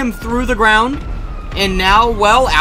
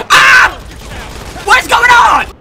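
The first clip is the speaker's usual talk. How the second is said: much higher startled shout; startled yell; 10 semitones higher, 4 dB louder, much slower than usual. Real, not acted. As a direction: yelp in shock then demand. Sudden attack.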